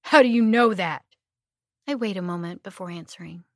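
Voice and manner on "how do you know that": loud, high angry yell. Acted. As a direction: yell it toward the voice. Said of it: "he yells".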